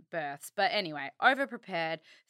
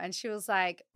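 ordinary talk

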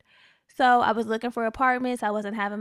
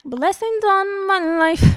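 hammed-up blessing; 9 semitones higher, 10 dB louder, slower than usual. Joking blessing after free night.

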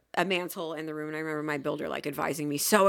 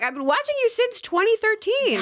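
excited fan; 4 dB louder than usual. Retold or imitated. Fan gushing to her idol.

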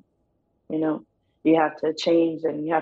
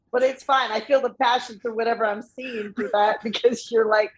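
shouting over noise; 7 semitones higher than usual. Retold or imitated. Imitating fan straining over noise.

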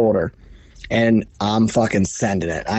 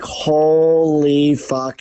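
long drawn-out, awed swearing; slow, higher-pitched amazement; awed disbelief; 4 semitones higher, much slower than usual. Retold or imitated. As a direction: stretch it out very slowly in disbelief. Awed as the buck comes toward him.

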